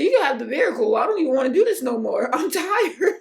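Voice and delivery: loud, high, hammed-up surrender; mock exasperated wail. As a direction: cry it out theatrically, breaking into laughter. Acts out tearful giving up.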